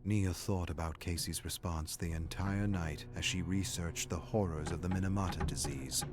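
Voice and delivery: ordinary talk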